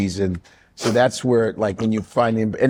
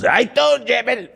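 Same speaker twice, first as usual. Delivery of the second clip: high put-on Trump voice; everyone's Trump impression; 10 semitones higher than usual. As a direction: do the common Trump imitation. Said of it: "everyone goes".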